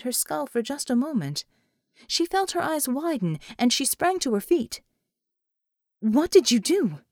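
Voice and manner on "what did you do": louder alarmed question; startled, loud demand; alarmed cry. A narrator's reading. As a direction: blurt it out in sudden alarm. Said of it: "she cried in alarm".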